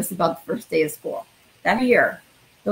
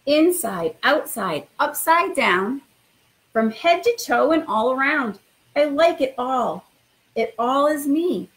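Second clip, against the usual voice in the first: expressive storytime read-aloud; reading to children; slower than usual. Acted. Principal reading a picture book to new students, lively storytime voice. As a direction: read it like bedtime story time for little kids.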